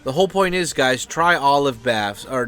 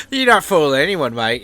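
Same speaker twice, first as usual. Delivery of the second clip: put-on Australian accent; 3 semitones higher, 6 dB louder than usual. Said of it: "you know, they're like".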